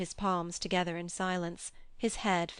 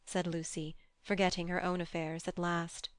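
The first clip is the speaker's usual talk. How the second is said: ordinary delivery, nothing marked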